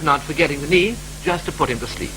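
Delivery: ordinary talk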